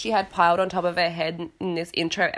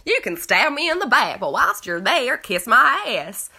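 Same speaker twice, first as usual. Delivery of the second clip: hammed-up Southern US accent, louder at the end; 5 semitones higher, 4 dB louder than usual. Host mocking Lisa's tagline in a hammed-up Southern accent. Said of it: "who would have a Southern accent".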